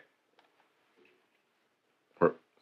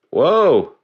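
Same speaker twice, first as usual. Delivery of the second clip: surprised whoa; 8 semitones higher, 5 dB louder than usual. Real, not acted. Reacting on stream.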